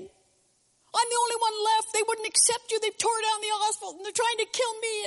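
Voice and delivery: very high, strained whine; hammed-up self-pitying protest, held high throughout; aggrieved complaint. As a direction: pour it all out in one high-pitched wail. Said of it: "he poured out his complaint again".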